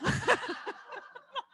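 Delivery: high-pitched burst of laughter; shrieking laugh. Real, not acted. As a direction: crack up at the punchline. Laughs after punchline.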